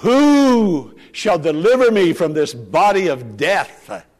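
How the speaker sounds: slow, loud anguished plea; crying out. Acted. Paul crying out to be delivered, loud and slow. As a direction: cry it out like a desperate apostle. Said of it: "Paul cries out".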